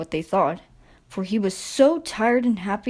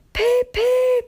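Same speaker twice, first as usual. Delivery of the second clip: very high, bright whistle toot; 3 dB louder than usual. A narrator's reading. Little engine whistling proudly as he leaves. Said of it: "he whistled".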